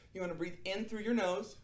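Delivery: usual speaking voice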